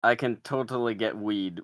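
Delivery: normal talking voice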